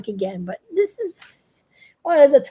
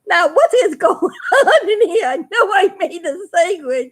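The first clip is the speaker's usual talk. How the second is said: higher, laughing through a confused cartoon voice; baffled Big Stuff; 6 semitones higher than usual. Man finds his sandwich gone, confused and laughing. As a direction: play the bewildered astronaut, half giggling. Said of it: "And he goes".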